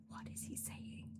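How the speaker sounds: whispered, voiceless aside; hushed bafflement. Retold or imitated. Recalling the phone call with her brother, hushed bafflement.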